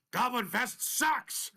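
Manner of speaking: high, slow taunting yell; character yell. Acted. Rogue taunting the goblin leader, high mocking yell. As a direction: spit the insult in his face. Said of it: "and I go".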